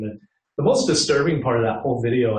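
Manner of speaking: ordinary talk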